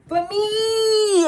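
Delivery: high, loud, stretched-out cry; drawn-out loud wail. Acted. Storyteller reacting to the ex news, drawn-out loud wail. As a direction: belt it out, dragging the last word. Mock triumph, stretched refrain.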